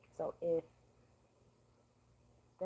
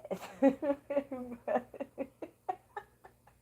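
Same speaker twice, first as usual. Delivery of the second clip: big rising laugh; loud laugh; 9 semitones higher, 12 dB louder than usual. Real, not acted. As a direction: burst out laughing. Loud laugh after outburst.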